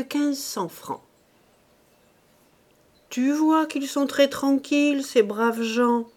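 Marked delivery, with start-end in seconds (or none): 3.1-6.1 reassuring wife. A narrator's reading.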